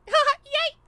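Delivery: sudden very high, bright-loud yay; high Misty voice. Shown as a demonstration. Voice actor showing off her cartoon girl voice, high yay. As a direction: pop up into a carefree squeal of joy. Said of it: "it's really fun to just, like".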